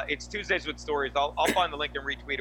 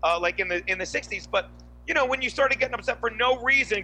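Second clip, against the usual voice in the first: ordinary talk, nothing marked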